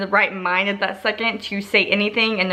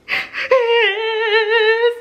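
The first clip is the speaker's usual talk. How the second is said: long, high-pitched sobbing squeal; exaggerated bawling in place of an answer; mock crying wail; 14 semitones higher, 4 dB louder than usual. Imitating crying instead of answering.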